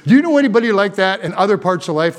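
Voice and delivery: normal talking voice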